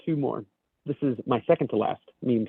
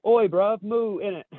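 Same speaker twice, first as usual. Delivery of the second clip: put-on higher-pitched British slang accent; British cow; 6 semitones higher, slower than usual. Host reads British flag cow in meme, British accent. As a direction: moo like a British lad. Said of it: "the British flag cow says".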